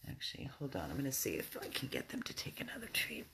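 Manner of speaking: quick hushed whisper; fast conspiratorial whisper. Real, not acted. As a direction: whisper it so the dogs won't hear. Whispering aside to camera.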